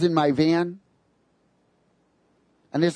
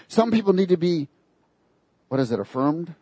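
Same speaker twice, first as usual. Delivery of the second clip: ordinary delivery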